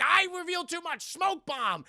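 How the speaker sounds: high, loud hammed-up Batman; mock Batman. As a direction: announce the exit like a cartoon. Batman parody exits.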